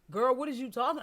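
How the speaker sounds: high, loud imitation of a defiant kid; sassy disbelief. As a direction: mimic your son's incredulous sass. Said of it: "He was looking at me like".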